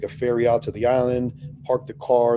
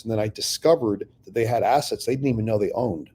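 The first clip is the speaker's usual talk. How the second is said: usual speaking voice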